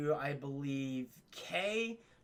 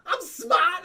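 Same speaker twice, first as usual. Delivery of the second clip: Fredo's shout; 13 semitones higher, 10 dB louder than usual. Retold or imitated. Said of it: "And Michael goes like".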